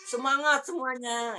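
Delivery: high, drawn-out rallying cheer; rally the whole class with energy; lively intonation. Shown as a demonstration. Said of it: "Tapi pakai intonasi". (seruan semangat bernada tinggi)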